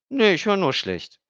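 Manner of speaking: put-on high cheeky girl's voice; a kid playing hard of hearing; little girl. A narrator's reading. Emmy teases Stefan about her hearing, high girl's voice.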